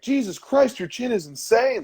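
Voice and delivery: high-pitched mock yell; imitated yelling. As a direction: retell a fighter's yelled amazement without the volume. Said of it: "He was really yelling at him".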